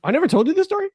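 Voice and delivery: high, loud disbelief; real surprise, asked to his friend; surprised. Real, not acted.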